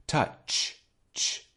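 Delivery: "Touch" ending in pure air; airy, voiceless ch; unvocalized last sound. Shown as a demonstration. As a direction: isolate the "ch" of "Touch" without voice. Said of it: "is an unvocalized sound".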